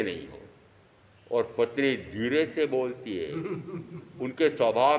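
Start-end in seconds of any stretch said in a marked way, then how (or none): none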